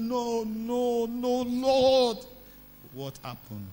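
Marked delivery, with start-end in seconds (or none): 0.0-2.2 high, drawn-out tearful protest; hammed-up wail of refusal; mock wailing. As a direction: moan it high, pleading with heaven. Retold crying woman protests.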